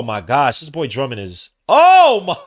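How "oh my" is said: high, loud disbelieving cry; real stunned outburst; shocked shriek. Real, not acted. Disbelief at Drummond.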